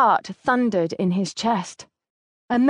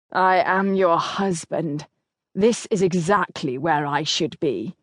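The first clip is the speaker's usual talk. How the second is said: stern husband; 4 semitones lower than usual. Acted. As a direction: voice the determined husband, unhurried. Narrator voices the duke.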